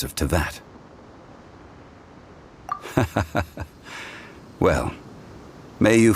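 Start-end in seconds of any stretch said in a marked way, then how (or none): 2.7-3.6 breathy quiet chuckle; a small soft laugh to himself; gentle unvoiced chuckle. Real, not acted. Musing on traveler recording history.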